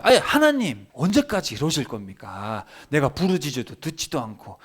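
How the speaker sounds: indignant protest, leaping high at the start; acted exasperated complaint; arguing with God. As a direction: plead your case accusingly to God. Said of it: "하나님한테 따지는 거예요".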